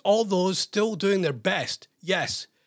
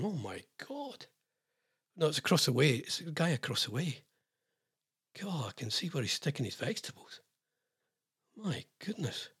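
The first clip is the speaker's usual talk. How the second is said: hushed aside; 2 semitones lower, 8 dB quieter than usual. Real, not acted. Muttered aside about the neighbour.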